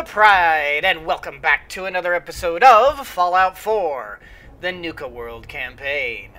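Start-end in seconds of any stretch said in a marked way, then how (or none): none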